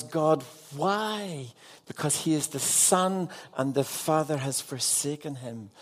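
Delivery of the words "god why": higher, pained plea; anguished cry. Acted. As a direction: cry out the question to God. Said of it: "And Jesus cries".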